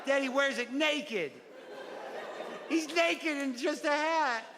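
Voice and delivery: put-on high-pitched little kid telling about a favorite book; child's book report. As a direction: play a giggly child presenting his book.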